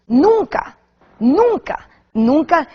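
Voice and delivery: each nunca high and loud, rising; emphatic nunca. Real, not acted. Daughter insisting her father never spoke ill, emphatic.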